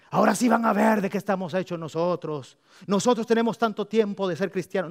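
mock veteran believer boasting loudly; loud proud boast. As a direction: crow about your years in church.

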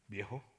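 反问语气; questioning echo. Retold or imitated. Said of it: "Es que viejo".

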